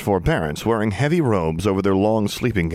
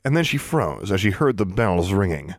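plain reading voice

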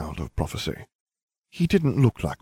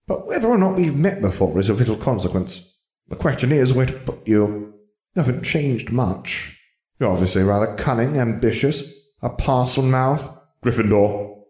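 higher-pitched, musing character voice; Sorting Hat, deliberating; 6 semitones higher than usual. Acted. Hat deciding where to put Harry, musing. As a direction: weigh each trait aloud as a pondering hat.

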